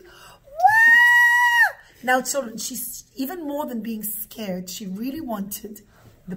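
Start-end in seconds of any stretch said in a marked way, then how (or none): 0.0-1.8 long, loud, very high wail; scream. Acted. Old mother screams to make the policemen leave, wailing. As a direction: shriek it out and hold it. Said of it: "she started to scream".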